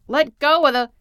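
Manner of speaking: high, loud shout cut short; urgent yell. A narrator's reading. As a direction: shout an order, broken off mid-sentence.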